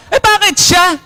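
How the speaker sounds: high, whining "Eh, bakit siya?"; hammed-up envious complaint; mock jealous whine. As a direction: play the envious one, pitch up. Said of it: "Narinig nyo na yan?".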